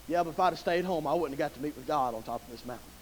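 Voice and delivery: very soft, low inner voice; hushed afterthought. Acted. Isaac reassuring himself on the climb, hushed and low. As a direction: drop almost to a murmur, as if thinking it.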